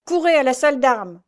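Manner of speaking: lieutenant shouting an order; commanding order. A narrator's reading. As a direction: send the men running. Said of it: "commanda le lieutenant".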